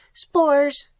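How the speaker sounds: high, shrill alien reply; shrill it like a strange creature. A narrator's reading. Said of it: "shrilled the mystery".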